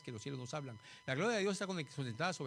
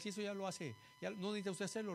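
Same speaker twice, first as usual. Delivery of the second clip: normal talking voice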